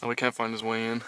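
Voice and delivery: usual speaking voice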